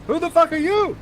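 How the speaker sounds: high character voice. Acted. Characters trade the same shout.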